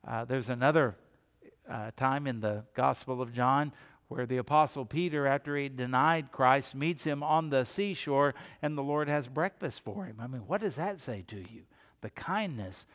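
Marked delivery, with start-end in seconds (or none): none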